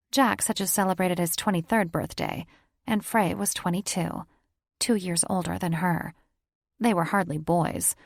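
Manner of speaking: usual speaking voice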